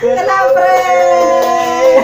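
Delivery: very slow, loud, drawn-out taunt; teasing shout. Real, not acted. Mother to son revealing the prank, teasing shout. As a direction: drag out "prank" loudly.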